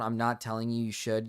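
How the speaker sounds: ordinary talk, nothing marked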